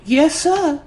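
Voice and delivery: put-on high, sweet southern child saying yes sir; a child's high, southern-tinged yes sir; little girl's voice. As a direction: voice the polite little girl. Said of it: "And she says".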